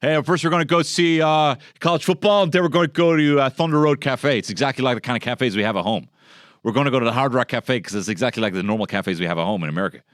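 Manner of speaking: high, loud, gushing American accent mocking Irish-Americans; brash American tourist. Comedian mocks Irish-Americans visiting home, in a loud high American accent. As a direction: play the over-eager American accent, all confidence. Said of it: "You're like".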